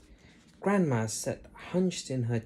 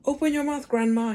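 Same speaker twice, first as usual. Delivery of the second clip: George crying out; 12 semitones higher, 7 dB louder than usual. A narrator's reading. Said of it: "he cried".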